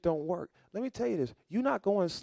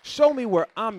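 higher and louder, crying out to God; loud plea; 7 semitones higher, 7 dB louder than usual. Shown as a demonstration. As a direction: lift the plea up high. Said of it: "prayer repeated louder".

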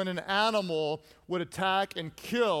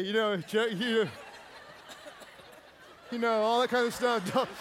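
ordinary talk

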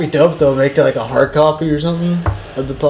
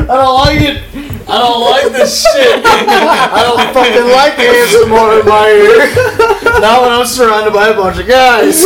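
laughing; 8 semitones higher than usual. Real, not acted. Reacting to ASMR, laughing.

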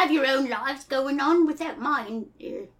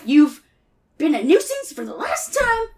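indignant Rabbit; 3 semitones higher than usual. Acted. Rabbit runs his mouth off at Pooh, indignant. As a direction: build to an outraged squawk.

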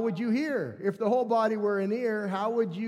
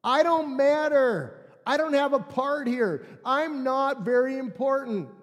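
higher, emphatic mock self-pity; insistent, raised complaint; acted feeling of not belonging; 5 semitones higher, 5 dB louder than usual. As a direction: voice the left-out member, pitched up and forceful. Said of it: "what happens in that attitude is simply this".

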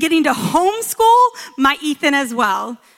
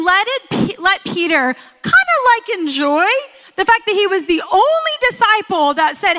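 much higher, eager, half-joking plea; playful plea to Jesus; 6 semitones higher than usual. Retold or imitated. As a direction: beg Jesus playfully on Peter's behalf. Preacher pleading with Jesus.

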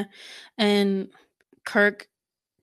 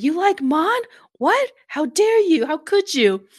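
high, loud indignant outburst; outraged retelling; 8 semitones higher, 6 dB louder than usual. Retold or imitated. Kun-sam confronts Kirk over Mon, outraged and loud. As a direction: play up the shocked accusation, pitch jumping on each question.

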